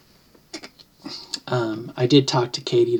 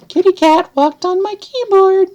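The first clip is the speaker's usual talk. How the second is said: high sing-song voice; 6 dB louder than usual. Pretend. Vlogger excusing a cat on the keyboard, high sing-song. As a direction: squeal it out in a tiny high voice.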